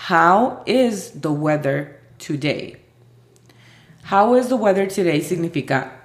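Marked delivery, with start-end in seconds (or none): 0.1-2.8 very slow, word by word; slow model question. Shown as a demonstration. Teacher presenting a new question, slow and deliberate. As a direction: pronounce each word apart. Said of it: "Otra pregunta parecida sería".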